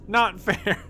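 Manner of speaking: words breaking into a laugh; a protest that cracks up mid-word. Real, not acted.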